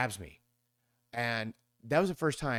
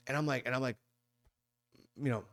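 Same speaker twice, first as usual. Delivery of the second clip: ordinary talk, nothing marked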